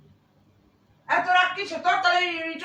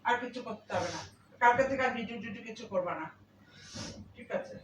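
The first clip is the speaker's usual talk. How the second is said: soft, low, comforting voice; quiet reassurance, lower and softer towards the end; tender soothing; 5 semitones lower, 7 dB quieter than usual. Real, not acted. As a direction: gently hush someone who is crying. Consoling the crying person, tender reassurance.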